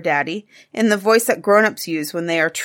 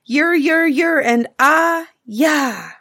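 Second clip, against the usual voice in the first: higher, slow yur yur yur ah yah; imitating the mixy noise; 9 semitones higher, 5 dB louder, slower than usual. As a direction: sound out the noise piece by piece. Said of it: "what can we do about the front part".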